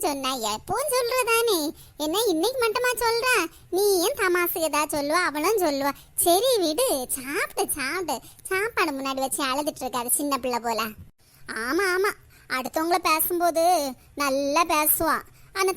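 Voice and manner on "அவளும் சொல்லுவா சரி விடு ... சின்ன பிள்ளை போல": ordinary delivery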